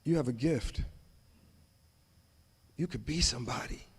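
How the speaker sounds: low, soft, sincere encouragement. Retold or imitated. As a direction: say it gently like a mentor. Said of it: "that teacher said".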